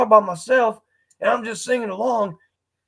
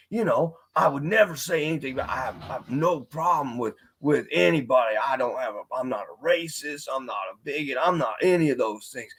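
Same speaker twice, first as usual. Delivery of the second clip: put-on country accent; 6 semitones lower, 3 dB quieter than usual. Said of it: "like, a country accent".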